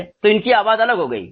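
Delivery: ordinary talk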